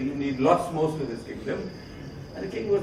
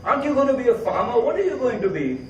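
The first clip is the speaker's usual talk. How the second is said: higher, insistent questioning; pressing father; 7 semitones higher than usual. Acted. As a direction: demand an answer from the silent son. Father pressing silent son.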